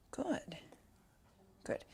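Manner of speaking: soft breathy good; whispered praise. Real, not acted. Trainer rewarding the puppy, whispered good. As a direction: whisper it to the dog.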